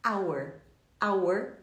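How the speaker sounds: "Hour" begun on the vowel, like "our"; silent H. Shown as a demonstration. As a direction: repeat "Hour" with no H. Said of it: "o H não é pronunciado".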